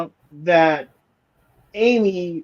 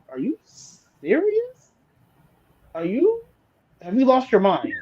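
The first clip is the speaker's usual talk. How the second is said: incredulous; 7 semitones higher, 3 dB quieter than usual. Retold or imitated. Co-host mocking Amy's rant, high incredulous disbelief. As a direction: stretch it out in shock, pitched way up.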